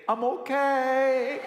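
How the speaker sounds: high, slow little voice; a brave small boy after a fall. Retold or imitated. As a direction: son calling out from the dark garage.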